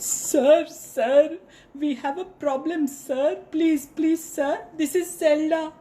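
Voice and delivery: high, stammering, pleading monkey; monkey begging the lion not to eat him; nervous monkey. A narrator's reading. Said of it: "So the monkey said".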